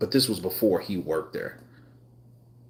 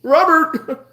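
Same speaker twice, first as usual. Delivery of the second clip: high, louder mock call for Robert; 6 dB louder, slower than usual. Imitating staff searching the store, high mock shout. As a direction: shout the name up high, hammed-up. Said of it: "I guess that stands for Robert".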